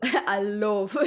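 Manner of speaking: teasing imitation of a garbled "love"; mock the wrongly voweled "love"; mimicked mispronounced love. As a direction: copy the botched "love" with an odd vowel. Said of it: "I couldn't say love".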